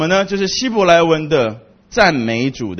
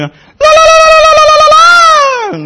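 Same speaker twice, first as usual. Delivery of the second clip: fast, very high, loud trilled lalala; tongue-trill ululation; 9 dB louder, much faster than usual. Shown as a demonstration. Preacher showing how Kenyan women trill, loud and high. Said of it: "用弹舌".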